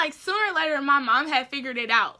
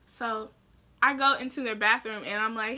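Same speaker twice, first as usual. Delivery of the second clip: usual speaking voice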